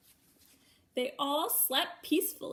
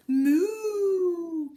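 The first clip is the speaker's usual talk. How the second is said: a louder, higher playful moo; cow moo; 5 semitones higher, 9 dB louder than usual. Acted. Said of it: "who muttered".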